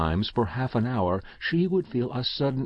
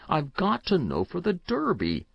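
repeated plea pitched higher; boy reiterating; 8 semitones higher than usual. A narrator's reading. Boy repeating to Uncle Oscar, higher desperate insistence. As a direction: press it again, more desperate and higher. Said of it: "the child reiterated".

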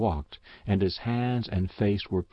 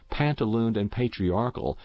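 usual speaking voice

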